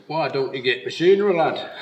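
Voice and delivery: broad northern English voice; northern accent. Retold or imitated. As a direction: say it like a blunt Yorkshire engineer. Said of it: "in a very northern accent".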